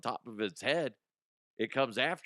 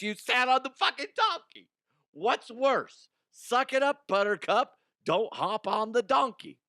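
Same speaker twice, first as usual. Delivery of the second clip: much higher and louder, scolding the listeners; ranting; 10 semitones higher, 5 dB louder than usual. Real, not acted. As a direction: bark out the verdict, worked up. Ranting at donkey riders.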